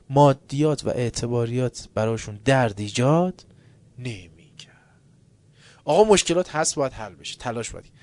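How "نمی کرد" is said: slow whispered ending. Acted. Preacher whispering the point, slowly. Whispered end of sermon line.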